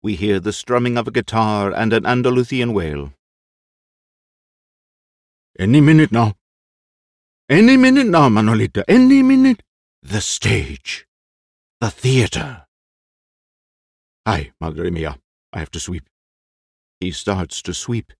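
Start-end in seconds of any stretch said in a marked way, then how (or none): 5.6-16.1 put-on fussy, anxious old actor; eccentric old actor. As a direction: play the jittery old actor. Voices Lolo, an old actor.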